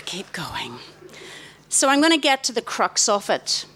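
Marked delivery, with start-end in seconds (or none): 0.0-0.8 hushed, breathy whisper to Jennifer. Real, not acted. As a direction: whisper the prompt right into her ear.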